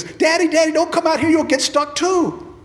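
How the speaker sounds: put-on high, loud little girl voice; girl calling from the mud; child's warning call. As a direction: warn him off like a small child. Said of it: "she says".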